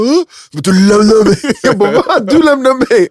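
very high, loud comic outrage; mock indignant protest. Woken up and accusing his friend of leaving, mock indignation. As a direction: protest in hammed-up disbelief. Acted quarrel over who left whom.

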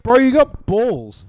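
loud, slow, drawn-out bro shout; mock hype shout. Mate hyping up a worker calling in sick, a loud, slow shout. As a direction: boom it out slowly like a hyped-up mate.

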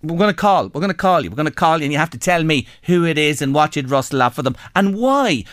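ordinary talk

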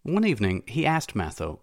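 usual speaking voice

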